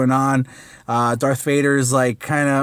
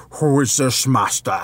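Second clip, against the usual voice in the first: horrible Darth Vader voice; 2 semitones lower than usual. Retold or imitated. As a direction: try a menacing Vader voice and botch it. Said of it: "That was horrible".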